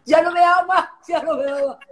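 wife complaining to her pastor about her husband. Retold or imitated. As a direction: plead like a hurt young wife. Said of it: "le decía".

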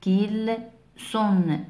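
"كيلسون" broken into kil, son; syllable by syllable. Shown as a demonstration. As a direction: sound out "كيلسون" piece by piece. Teacher reads example syllable by syllable.

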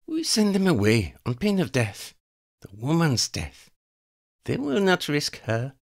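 high, cold voice of a female vampire plotting murder; scheming vampire woman. Acted. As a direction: savour each threat like a predator. Elena threatens.

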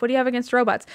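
normal talking voice

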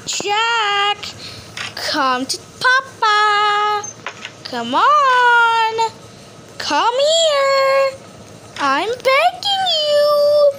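slow, high, begging whine at the end; pleading, rising to high. Real, not acted. Pleading for Jack to appear.

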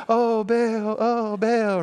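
high, drawn-out mock wail; hammed-up voice-actor cry to Baal; audio Bible voice. Imitating audio Bible actors crying out to Baal, high mock wail. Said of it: "sometimes they do voices like".

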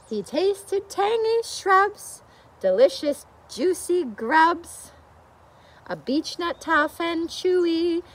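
lively storybook reading; reading to children. A narrator's reading. Teacher reads the bear book to children, storybook voice. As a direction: read it out for a room of little ones.

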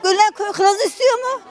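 ordinary delivery

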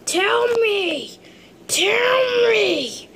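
building to a high crying shout; desperate shout. Pretend. Blinded figure begs Hulk.

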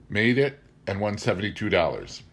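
ordinary delivery

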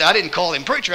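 ordinary delivery

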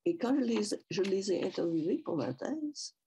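normal talking voice

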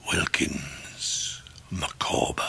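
slow, soft whisper of a crushed man; despairing whisper. Acted. Micawber signs off his letter of ruin, slow despairing whisper. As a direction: let the signature die away slowly.

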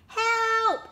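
high, loud cry; crying for help. A narrator's reading. Elephant pulled by crocodile, crying out. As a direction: yell it out in alarm. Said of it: "cried elephant".

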